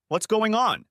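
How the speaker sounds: high, frightened plea; frightened wife asking her husband what's wrong; scared wife. Acted. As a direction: sound like she fears the answer.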